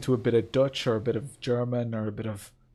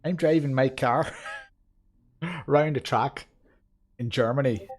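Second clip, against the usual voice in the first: hammed-up Belfast accent, breaking into laughs; 2 semitones higher, slower than usual. Streamer doing an accent for a new member, Belfast accent. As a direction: put on a halting, lilting Belfast accent. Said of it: "is that Belfast?".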